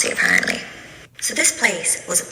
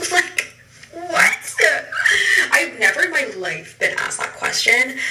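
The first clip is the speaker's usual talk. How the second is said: laughing in disbelief; 8 semitones higher than usual. Real, not acted. Telling viewers about the follower question, laughing. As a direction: laugh through the words, disbelieving.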